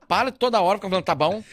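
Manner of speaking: wife fed up with his tá bom, irritated. Retold or imitated. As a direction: snap at him in annoyance.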